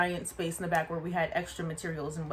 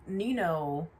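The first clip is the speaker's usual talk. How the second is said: normal talking voice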